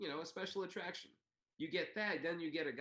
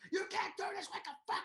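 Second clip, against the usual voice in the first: much higher screeching mock yell; screaming and cursing. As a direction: rant like a furious manager. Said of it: "comes screaming and cursing up".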